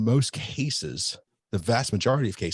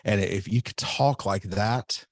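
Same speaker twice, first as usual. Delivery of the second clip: normal talking voice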